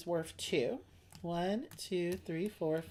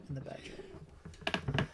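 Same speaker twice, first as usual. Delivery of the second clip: suggestive whisper; 4 semitones lower, 7 dB quieter, much slower than usual. Pretend. Suggestive punchline about teachers.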